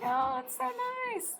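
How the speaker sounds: very high, sugary cooing; high-pitched put-on sweetness; mock delight. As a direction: parody a gushing reaction in a high voice. Recap of the delighted reaction.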